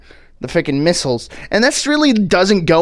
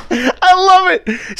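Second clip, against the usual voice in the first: slow, high, laughing through the words; 4 dB louder, much slower than usual. Real, not acted. Reacting to the movie, laughing through it. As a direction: still cracking up while talking.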